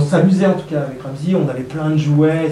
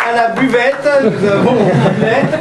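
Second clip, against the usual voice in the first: annonce au public; 8 semitones higher, 8 dB louder than usual. Real, not acted.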